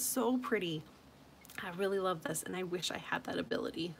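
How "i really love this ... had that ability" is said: whisper. Real, not acted. Wistful aside about her skill.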